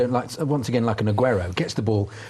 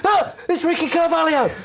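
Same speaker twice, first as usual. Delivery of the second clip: excited fan shout. Retold or imitated. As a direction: mimic a stranger yelling at a celebrity sighting. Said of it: "he went, he went".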